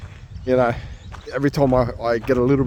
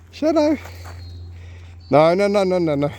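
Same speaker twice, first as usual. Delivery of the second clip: scolding call; 7 semitones higher than usual. Real, not acted. Owner scolding Shadow for smelling poo. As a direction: stop the dog sniffing poo.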